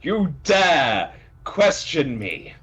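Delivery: high, loud, pompous declaiming; outraged proclamation. Acted. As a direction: thunder it grandly, slowing into the challenge. Brutus proclaims himself in outrage.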